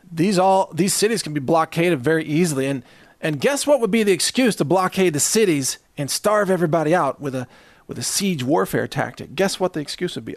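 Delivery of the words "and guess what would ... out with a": usual speaking voice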